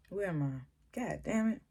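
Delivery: soft mutter, lost in her notes; half under her breath, annoyed at losing her place; muttering to herself. Real, not acted. Vlogger muttering to herself after losing her place.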